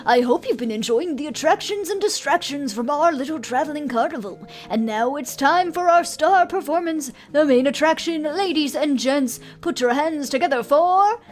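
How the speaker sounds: ringmaster voice. Acted. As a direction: announce it like a big-top ringmaster. Ringmaster greets crowd.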